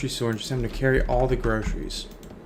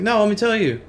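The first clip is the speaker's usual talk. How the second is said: a hint of a higher, excited kid; eager little girl; 7 semitones higher than usual. Second little girl eager to tell mom, lightly voiced kid. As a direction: blurt it out like a kid with a secret. Second daughter bursting with news.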